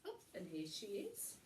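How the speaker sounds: ordinary delivery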